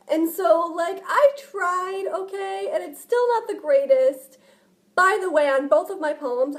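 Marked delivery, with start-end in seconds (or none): none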